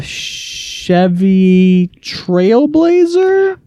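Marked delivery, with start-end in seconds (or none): 2.0-3.6 a high, drawn-out unsure question; really high voice. Real, not acted. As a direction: voice jumps way up, stretched slow. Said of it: "our voices get really high".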